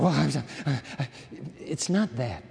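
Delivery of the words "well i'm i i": mock flustered stammer; emotional stammering. Preacher mimicking an emotional worshiper, flustered. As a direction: stumble over the first words, acting overwhelmed. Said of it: "It's not emotional".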